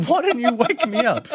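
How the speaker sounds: high, loud mock-indignant complaint; indignant protest. Teller retells complaining to mate for leaving, high indignant protest.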